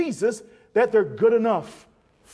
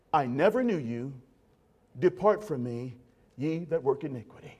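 low, grave rejection; solemn Jesus; 8 semitones lower, 4 dB quieter than usual. Retold or imitated. Jesus rejecting the boastful preacher: low solemn verdict. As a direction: pronounce it like a solemn judge. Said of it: "the Lord Jesus would say to him".

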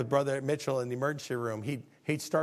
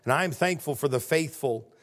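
closing prayer; 2 semitones higher, 5 dB louder than usual. Acted.

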